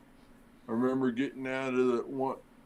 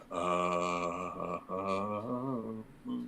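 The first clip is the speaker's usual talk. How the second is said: low, soft, halting uh uh uh, lost for words; stunned stammer; 4 semitones lower, 5 dB quieter, much slower than usual. Retold or imitated. Firefighter hears the whole city is on fire, stunned speechless. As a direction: let the words die as the shock lands.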